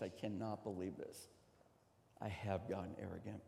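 low, hushed admission; soft, subdued self-quote; humbled realization. Retold or imitated. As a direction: drop right down and confess it quietly. Quoting his humbled realization.

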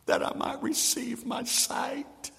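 slow, whispered request; hushed plea. Acted. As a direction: whisper it like a humble prayer. Blind man's plea to Jesus.

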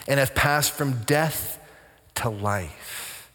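slow, weighted delivery with a pause before "to life"; measured scriptural gravity; solemn. Real, not acted. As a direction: let "to life" drop low and soft. Scripture line slowed for solemn weight.

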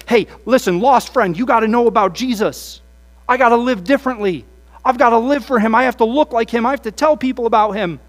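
loud, high, eager preaching to a friend; urgent, pumped-up plea; zealous evangelist. Retold or imitated. Student to lost friend after camp, loud and zealous.

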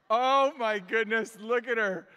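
loud, high, drawn-out adoration; slow, melting delight; doting gush. Real, not acted. Gushing over baby daughter's photo.

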